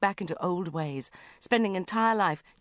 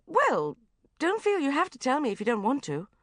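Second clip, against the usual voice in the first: bright; 8 semitones higher, 3 dB louder than usual. Acted. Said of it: "I said brightly".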